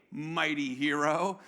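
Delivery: low, drawn-out mock-admiring address; slow and low, tipping up at the end, half questioning; possibly sarcastic greeting. Angel hails a man hiding in fear, slow dubious sarcasm. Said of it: "I can't understand the tone here. I don't know if this is sarcasm".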